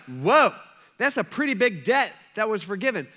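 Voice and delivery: loud wowed disciples; a big "whoa", then marvel at it; impressed amazement. Acted. Disciples reacting to the forgiven debt, impressed amazement.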